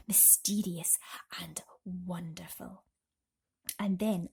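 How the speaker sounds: ordinary delivery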